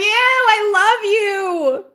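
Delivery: high, very slow, crying I love you; tearful. Real, not acted. Thanking a kind viewer, crying out the words. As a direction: stretch it out, voice breaking with tears.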